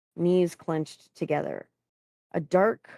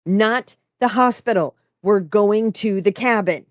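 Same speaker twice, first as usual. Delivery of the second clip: loud, raised, commanding voice; authoritative bark; 5 semitones higher, 7 dB louder than usual. A narrator's reading. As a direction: lay down the order forcefully. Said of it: "barked with uncharacteristic authority".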